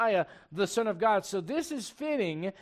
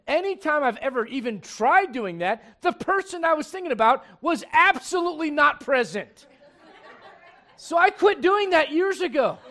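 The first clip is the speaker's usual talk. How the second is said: louder, higher-pitched preacher's delivery; fired-up preaching; 6 semitones higher, 9 dB louder than usual. Real, not acted. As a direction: drive it home to the congregation. Preaches at full force.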